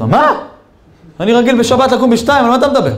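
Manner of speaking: high-pitched, outraged disbelief; incredulous protest. Retold or imitated. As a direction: act out a guest balking at the wake-up time. Acted protest at early wake-up.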